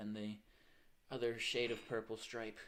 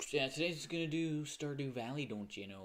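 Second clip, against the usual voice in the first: put-on Midwest accent; 4 semitones higher, 5 dB louder than usual. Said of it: "that Midwest accent for it".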